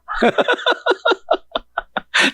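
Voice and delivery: high staccato chuckle; a long run of quick, high-pitched chuckles; laughing. Real, not acted. Laughs after mocking hidden feelings.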